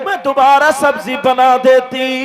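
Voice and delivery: loud, driving sermon at full force; fiery preaching. Acted. Preacher tells the crowd of the Prophet's kindness, fiery full-force preaching. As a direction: preach it out with fervour.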